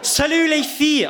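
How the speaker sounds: high, loud, hammed-up French greeting; hammed-up French accent. Greeting his friends before the trick, in a hammed-up French accent. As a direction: ham up a French accent as a showman would. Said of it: "to really ham it up and lean into it".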